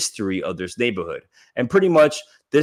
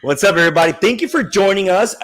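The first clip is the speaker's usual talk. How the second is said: louder, higher host welcome; podcast host opening the show, louder and higher; louder greeting; 7 semitones higher, 5 dB louder, faster than usual. Real, not acted. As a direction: boom out the welcome to the audience. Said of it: "when I wanna speak louder".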